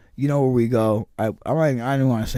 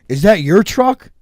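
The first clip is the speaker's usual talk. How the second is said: rude ranger; 7 semitones higher, 8 dB louder, slower than usual. Retold or imitated. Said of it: "who the fuck".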